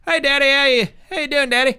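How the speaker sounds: loud, high-pitched Southern accent. Shown as a demonstration. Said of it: "most committed Southern accent".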